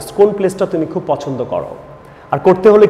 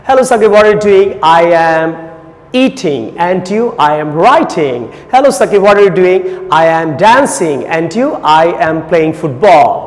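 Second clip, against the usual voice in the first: loud, drawn-out English drill, questions pitched up; solo practice dialogue; 2 semitones higher, 4 dB louder, much slower than usual. Shown as a demonstration. Teacher showing students how he practised alone as a child, emphatic drill. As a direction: rehearse both sides of a dialogue with emphatic, stretched words. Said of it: "আমি যেভাবে ছোটবেলায় করতাম".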